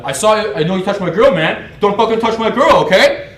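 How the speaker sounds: high, very loud jealous tirade; imitated angry shout. Jealous boyfriend accusing his rival, shouting angrily. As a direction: act out a boyfriend losing his temper.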